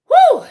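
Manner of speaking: loud, high "whew" of release; sharp high whoop of relief; exhaling whew. Real, not acted. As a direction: let out a big whew after a rant. Winding down after a rant.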